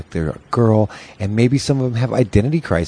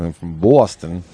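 rounded Boston vowel on "Boston"; Boston accent; 3 semitones lower than usual. Shown as a demonstration. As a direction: lower demo of a Boston accent. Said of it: "you said Boston, not Austin".

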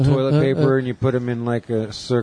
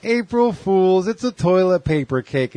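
parents gloating over the prank; gleeful prank reveal; 8 semitones higher than usual. Retold or imitated. Parents revealing the fake cake to their son, gleeful sing-song. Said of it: "they're like".

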